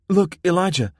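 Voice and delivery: high, loud excited call; excited girl. A narrator's reading. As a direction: point it out eagerly. Becky pointing ahead, excited.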